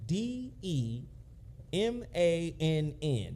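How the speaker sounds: each letter spelled out slowly, one by one; spelling it out. Shown as a demonstration. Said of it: "Freddie D Man".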